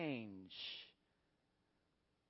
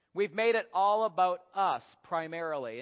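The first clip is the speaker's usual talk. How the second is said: ordinary talk, nothing marked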